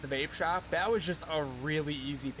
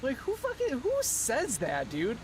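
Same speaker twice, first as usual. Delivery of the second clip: high, incredulous squeak; shocked disbelief; 9 semitones higher, slower than usual. Retold or imitated. Retelling his reaction to the boss, high and incredulous. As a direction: act stunned at the insult. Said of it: "And I'm like".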